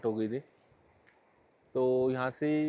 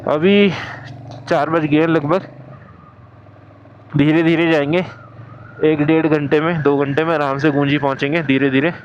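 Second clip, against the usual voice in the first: shouting over wind; 11 dB louder than usual. Real, not acted. Talking while riding the bike.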